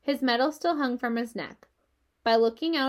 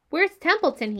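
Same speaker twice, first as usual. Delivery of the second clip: higher, louder, demanding question; urgent demand; 7 semitones higher, 5 dB louder than usual. A narrator's reading. Wilbur needing the rat quickly, urgent demand. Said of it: "he demanded".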